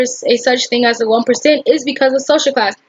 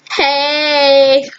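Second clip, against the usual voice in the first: drawn-out greeting; 2 semitones higher than usual. Acted.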